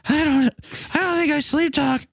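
high nervous cartoon kid; high Buster voice. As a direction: squeak the denial out.

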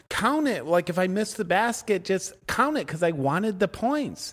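entitled complaint, lightly hammed up; mock demanding. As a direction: plead like a sore loser, playfully. Mimicking entitled demand to count miss.

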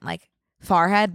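Farhead said slowly with a broad far vowel; Farhead pronounced. Shown as a demonstration. Showing off her classy Northeast speech, Farhead drawn out. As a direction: drawl Farhead as FAR-hed, savouring it. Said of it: "that's classy".

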